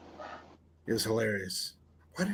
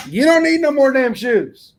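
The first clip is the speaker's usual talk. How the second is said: loud scolding; 12 semitones higher, 11 dB louder than usual. Acted. Scolding a wife over shoes.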